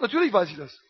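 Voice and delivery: lightly voiced patient God; calm God reply. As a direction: answer as one who knows it all.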